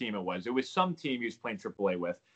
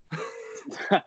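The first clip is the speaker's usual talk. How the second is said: breathy laugh; 5 semitones higher than usual. Real, not acted. Laugh after story.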